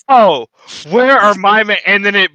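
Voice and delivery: loud, high cartoonish Jim Carrey squeal; hammed-up Jim Carrey yelp; Jim Carrey meme. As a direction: belt it like the Sonic movie meme. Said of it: "that meme where it's Jim Carrey".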